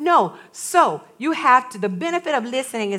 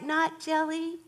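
put-on calm child voice; 4 semitones higher, 11 dB quieter than usual. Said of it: "it was like".

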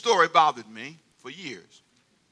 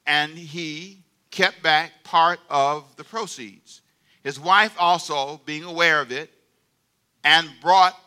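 slow, deliberate reading; careful slow scripture reading; 4 dB louder, slower than usual. Shown as a demonstration. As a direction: read it out slowly, word by word. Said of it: "I'm gonna read it slow".

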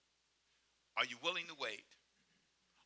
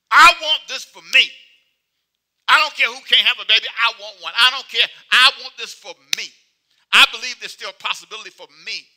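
loud, insistent, leaning on every I; determined; 3 semitones higher, 10 dB louder than usual. Acted. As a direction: demand it like a woman who won't give up. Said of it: "and she's determined".

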